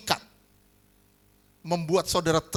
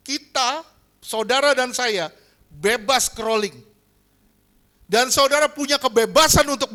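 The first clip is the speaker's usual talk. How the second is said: fervent preaching; 5 semitones higher, 7 dB louder than usual. Acted. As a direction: preach it to the congregation. Preacher at full force.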